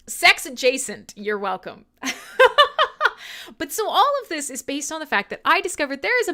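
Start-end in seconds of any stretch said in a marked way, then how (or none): 2.0-3.5 giggle. Real, not acted. Laughing at own joke.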